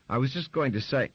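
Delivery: high, loud protest; indignant protest. Acted. Grace protesting to her son, high and loud. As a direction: try to get a word in.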